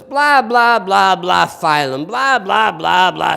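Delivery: loud, high, hammed-up blah-blah; mocking lecture. Professor opens entomology two, loud mocking imitation. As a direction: blare it out like a tiresome professor.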